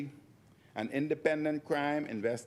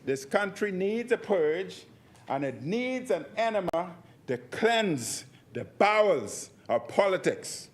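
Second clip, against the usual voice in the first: loud, higher, the purge line slowed; emphatic repeat; 6 semitones higher, 3 dB louder than usual. Real, not acted. MP repeating his call, loud and insistent. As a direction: pound it home once more. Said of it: "I repeat".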